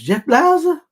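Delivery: high-pitched fake Cuban accent; hammed-up Cuban-accented messenger; bad Spanish accent. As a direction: announce it high in a badly faked Spanish accent. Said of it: "I wish I could do a Spanish voice".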